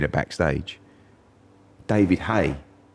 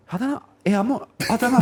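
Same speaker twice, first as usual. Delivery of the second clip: very high, squeaky nervous answer; mock nervous; faster than usual. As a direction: squeak it out, losing all confidence, half laughing. Acts out his nervous answer to dad.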